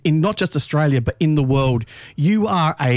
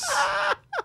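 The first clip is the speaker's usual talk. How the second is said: high, shrieking laugh; real laugh bursting out; 7 dB quieter than usual. Real, not acted. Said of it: "laughs again".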